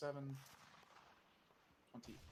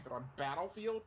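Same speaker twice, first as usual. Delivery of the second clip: incredulous; 5 semitones higher, 6 dB louder than usual. Pretend. Escalating incredulous repeats.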